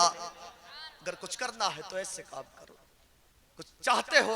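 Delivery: ordinary talk, nothing marked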